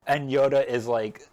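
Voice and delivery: ordinary talk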